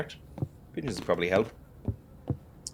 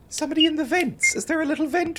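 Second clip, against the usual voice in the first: very high, playful squeal; silly high voice; faster than usual. Pretend. Player calling to a vent friend in a silly high voice. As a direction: coo it up high like to a little pet. Playful call to thing in vents.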